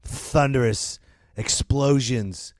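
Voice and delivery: ordinary delivery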